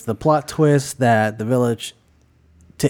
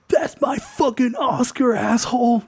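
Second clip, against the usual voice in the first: high furious yell at the thief; furious Brody; 10 semitones higher than usual. Acted. Adrian Brody grabbing the man's leg, high outraged yell. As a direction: scream it like a robbed actor. Actor screaming in story.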